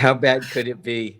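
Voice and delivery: mock his younger self with a laugh. Laughing at his own naive thought.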